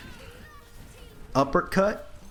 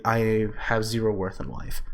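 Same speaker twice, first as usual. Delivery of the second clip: normal talking voice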